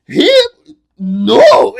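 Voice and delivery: loud, incredulous question and denial; hammed-up disbelief; imitated amazement. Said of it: "he's all fucking amazed".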